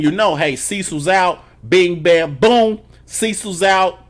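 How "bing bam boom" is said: sound effects. Retold or imitated. Acting out the fight.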